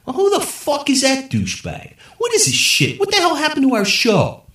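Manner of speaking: high, loud outraged stoner voice; stoner yelling at TV. A narrator's reading. Three stoners yelling at the TV, loud and high. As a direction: yell at the screen in a high put-on voice.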